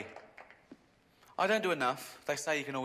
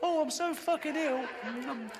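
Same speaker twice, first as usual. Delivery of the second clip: high, whining mock complaint; squealed mock illness, laughing; mock whiny sufferer; 13 semitones higher, 4 dB louder than usual. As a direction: whine like she's dying of it.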